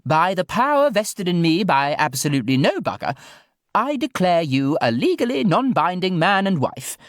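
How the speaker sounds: high, grand posh lady; plummy ceremonial flourish. Acted. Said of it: "concluded Judy".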